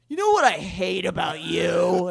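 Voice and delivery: loud mock drunken tirade; loud, accusing drunk outburst; belligerent drunk. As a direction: pick a fight like a hammed-up drunk. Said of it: "I'd drink, and I'd be like".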